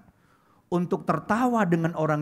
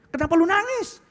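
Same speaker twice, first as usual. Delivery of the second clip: much higher, exclaimed question; incredulous questioning; 12 semitones higher than usual. Retold or imitated. Friend questioning a crying stranger at a funeral, incredulous. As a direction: blurt it out high in disbelief.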